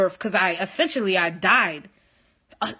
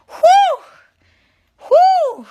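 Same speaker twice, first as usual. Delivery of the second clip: two piercing, overwhelmed whoops; exclaimed high "whoo", repeated; loud high whoops; 12 dB louder than usual. Real, not acted. Worked up about seeing professor.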